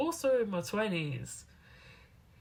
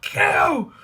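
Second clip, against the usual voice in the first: much higher, loud astonished cry in a put-on voice; imitated amazed shout; 10 semitones higher, 11 dB louder than usual. Strangers learning she's at Keio, imitated amazed shout. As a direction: shriek it, jaw-dropped. Strangers hearing her university.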